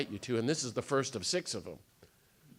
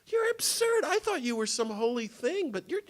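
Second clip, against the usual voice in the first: mock incredulous laughing; 11 semitones higher than usual. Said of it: "he goes".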